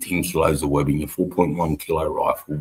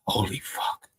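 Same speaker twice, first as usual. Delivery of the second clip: whispered shock; 4 semitones higher, 9 dB quieter, slower than usual. Retold or imitated. Pain from toes smashing.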